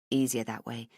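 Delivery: plain reading voice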